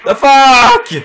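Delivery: high, loud baffled exclaim; shocked what-the; startled swear. Real, not acted. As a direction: blurt it out in shock.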